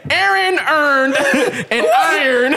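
slow mock Baltimore accent through laughter. Friend shows the others how Baltimore sounds, laughing Baltimore impression. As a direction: squash "Aaron earned" and "iron" Baltimore-style, drawn out. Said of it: "That's how they sound".

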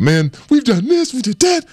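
mock whine of fed-up freshmen; complaining freshmen. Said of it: "And we'd say".

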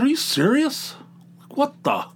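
high-pitched, incredulous self-quote; angry disbelief. Retold or imitated. Dad hearing his boy won, indignantly incredulous. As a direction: balk at the news, voice leaping up. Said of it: "almost made me angry".

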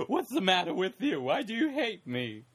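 lightly put-on Mad Hatter voice; a hint of a storybook madman. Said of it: "talk like Mad Hatter".

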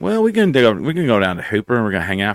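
quick, rambling West Texas talk; West Texas accent. Shown as a demonstration. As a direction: reel off plans in a West Texas twang. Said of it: "and then I can do it".

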